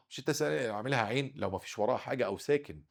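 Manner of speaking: usual speaking voice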